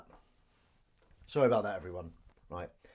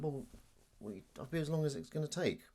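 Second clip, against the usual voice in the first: hushed, half-whispered comeback; timid retort; 6 semitones higher than usual. Retold or imitated. Scared gym-goer answering back, timid near-whisper. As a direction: mumble it under the breath as if scared. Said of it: "I didn't, 'cause I'm too scared".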